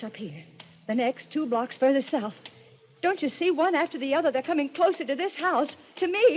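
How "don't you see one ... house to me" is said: higher and higher, panic building; rising terror. Acted. Kate to her brother, panic climbing higher. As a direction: voice climbing as the killings close in. Terrified woman tracing murders.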